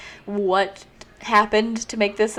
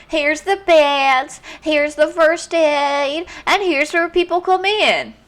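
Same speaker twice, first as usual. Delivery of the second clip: high, slow, sing-song tour guide; cheery guide voice; 7 semitones higher, 4 dB louder, much slower than usual. Acted. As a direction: chirp it out like a perky guide. Iris giving the tour.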